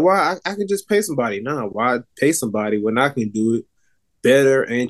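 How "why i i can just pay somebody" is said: mimicking a woman. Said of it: "they're like".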